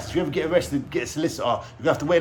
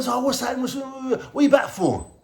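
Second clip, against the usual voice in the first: mock inmate greeting; 11 semitones higher than usual. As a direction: play the nosy cellmates asking why he's back. Inmates greeting him back in prison.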